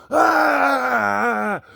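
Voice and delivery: long, high, loud "Ah"; scared scream. Real, not acted. Host scared by what he sees, frightened scream. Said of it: "that just scared the snot out of me".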